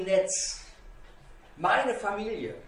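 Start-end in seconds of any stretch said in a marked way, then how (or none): none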